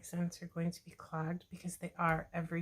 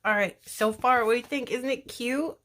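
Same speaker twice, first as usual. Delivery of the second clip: excited showing off; 5 semitones higher, 9 dB louder than usual. Real, not acted. Shows finished part to viewers.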